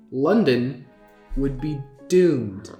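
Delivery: low, ominous drop onto "doomed"; pause, then draw out "doomed"; emphasis on doomed. A narrator's reading. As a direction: lean on "doomed" for dramatic effect. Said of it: "he emphasized this last word".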